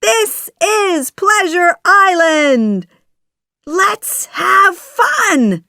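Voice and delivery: high, loud holiday cheer; shouting boys. A narrator's reading. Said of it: "shout the boys".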